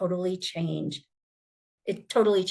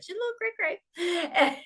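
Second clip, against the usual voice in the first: much higher, breaking into a laugh; retelling her own baffled thought, laughing higher; 11 semitones higher than usual. Retold or imitated. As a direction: giggle through "cray-cray". Retold thought about odd woman.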